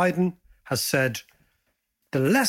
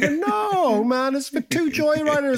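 mock joyrider; 9 semitones higher than usual.

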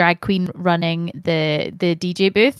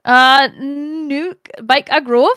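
put-on a rough, higher, louder stab at a Newcastle accent; attempted Newcastle accent; 5 semitones higher, 4 dB louder, much slower than usual. Friend asks for a Newcastle accent, a hammed-up try. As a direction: have a go at a Geordie Newcastle accent and ham it up. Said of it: "why don't you give it a bash".

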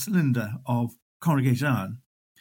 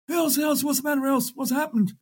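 high, worried calling of her name; alarmed landlord; 12 semitones higher than usual. Acted. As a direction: rouse the fainted girl in a panic. Landlord alarmed at fainted barmaid.

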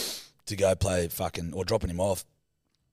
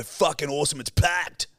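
higher-pitched enthusiastic mate; hyped-up quote, pitched high; mock excitement; 6 semitones higher than usual. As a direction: imitate a mate raving about the night. Acts mate raving about pub.

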